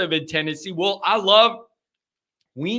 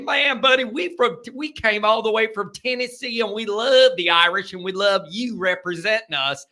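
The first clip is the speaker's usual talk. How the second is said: higher-pitched imitation Southern accent; 5 semitones higher than usual. Fan telling the host they came from Tennessee, Southern accent. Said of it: "they got accents".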